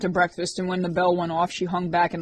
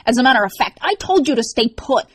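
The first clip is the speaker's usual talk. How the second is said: louder, higher stern rebuke; 6 semitones higher, 7 dB louder than usual. A narrator's reading. Rorik to Liz, sternly scolding her. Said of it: "he said sternly".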